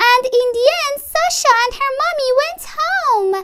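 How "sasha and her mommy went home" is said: plain reading voice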